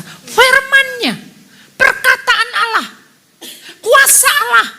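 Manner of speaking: high, slow, forceful preaching cry; drawn-out, high-pitched declaration to a congregation; emphatic proclamation. Real, not acted. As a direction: proclaim each phrase slowly and loudly. Preacher answers own question emphatically.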